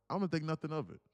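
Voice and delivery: ordinary talk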